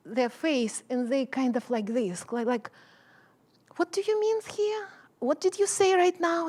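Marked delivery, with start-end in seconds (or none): 3.8-6.5 high confused questioning; puzzled students. Retold or imitated. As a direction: mimic the puzzled students asking. Students puzzled by her English.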